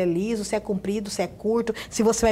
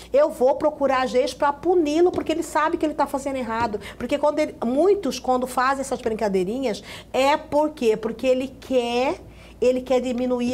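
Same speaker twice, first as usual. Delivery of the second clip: ordinary talk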